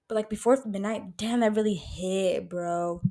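usual speaking voice

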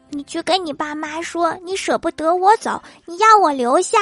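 put-on coaxing child. Kid coaching friend secretly.

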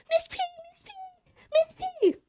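put-on very high, sing-song kid voices; kids calling out. Kids in the hallway calling to their teacher, high and eager. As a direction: call her name like excited little kids.

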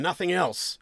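high, pressing NOTHING ELSE; sceptical question. Acted. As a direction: demand confirmation from the suspect. Sergeant's question, echoed in reply.

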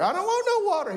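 put-on high-pitched childish refusal; protesting kid. Acts child refusing the water.

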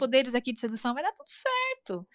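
high, sing-song voice, rising to a squeak at the end; hammed-up deluded optimism; mock self-confident. Narrator mocking her past self who was sure she'd win the boy, in a high, hammed-up, overconfident voice.

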